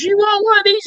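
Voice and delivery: very high, sing-song offering voice; sweet offer. Retold or imitated. Wife offering the stinky chips to the driver, high and sweet. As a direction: lightly mimic the wife's innocent offer.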